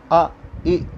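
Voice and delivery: crisp short अ and इ, clearly sounded; teacher models the short vowels अ and इ; short vowel demo. Shown as a demonstration. Teacher showing children short vowels, crisp and clear. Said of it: "ये संख्या में चार होते हैं".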